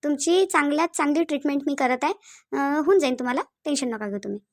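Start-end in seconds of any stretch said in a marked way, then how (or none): none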